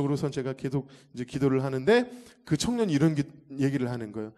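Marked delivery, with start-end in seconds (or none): none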